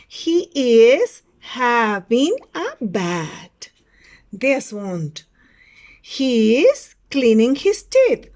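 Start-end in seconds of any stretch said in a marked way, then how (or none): 0.1-3.7 very slow and loud, each word separated; word-by-word model sentence. Shown as a demonstration. Teacher repeats the picture's sentence, very slow word-by-word delivery. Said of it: "Repeat after me". 6.0-8.3 slow, loud classroom model; model sentence for repeating. Shown as a demonstration. Teacher describes a picture to students, slow loud model delivery. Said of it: "Repeat after me".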